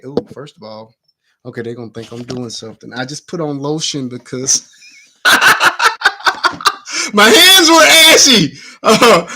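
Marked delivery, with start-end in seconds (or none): 5.2-9.3 laughing. Real, not acted. Laughing embarrassed confession.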